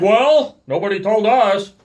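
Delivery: high, loud surprised protest; indignant protest. Retold or imitated. Arguing with the park worker, high indignant protest. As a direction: throw up your hands, voice up.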